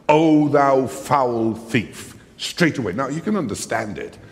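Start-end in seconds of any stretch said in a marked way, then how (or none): 0.1-2.1 loud, high, drawn-out accusation; angry bullying. Acted. Furious father to the man who took his daughter, angry and bullying. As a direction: bellow each word slowly in hammed-up fury. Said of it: "red-faced, angry, and bullying".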